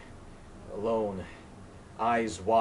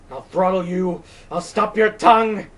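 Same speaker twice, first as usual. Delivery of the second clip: higher, much louder, raging threats; furious shout; 9 semitones higher, 12 dB louder than usual. Acted. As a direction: hurl the threats at the wind. Colt raging at the wind.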